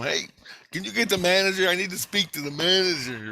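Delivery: insistently calling for the boss; demanding customer. Retold or imitated. Intruder asks desk girl for the manager, pushy demand.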